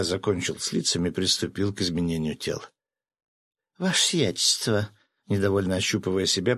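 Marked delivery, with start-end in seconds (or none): 3.8-4.9 higher, put-on woman's voice; cooing female voice. A narrator's reading. Fisherman turned woman addressing his leader, cooing female voice. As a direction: coo it like a woman, pitched up. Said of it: "проворковал женским голосом рыбак".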